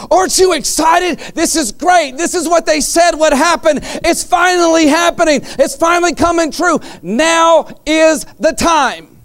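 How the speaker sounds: high, eager, shouted start, slowing at the end; retold overjoyed believer, pitched high; imitated excited man. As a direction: voice the giddy robust man. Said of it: "he's all excited".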